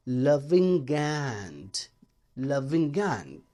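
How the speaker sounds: लविंग एंड said slowly with the g run into एंड; linked loving and. Shown as a demonstration. Teacher slowly demonstrating linked लविंग एंड. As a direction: glide लविंग straight into एंड, no break. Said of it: "आप इसको पढ़ेंगे लविंग एंड".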